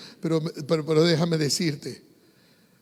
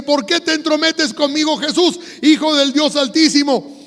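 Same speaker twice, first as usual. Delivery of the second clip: high-pitched, raised preacher's retelling of a cry; demon's cry; 8 semitones higher, 6 dB louder than usual. Retold or imitated. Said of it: "Y entonces grita". (grito imitado del endemoniado)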